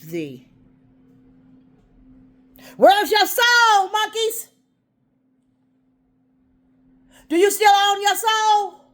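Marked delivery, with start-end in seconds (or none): 2.8-8.8 loud, high, slow accusing yell; angry shouting. Real, not acted. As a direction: demand answers at the top of your voice.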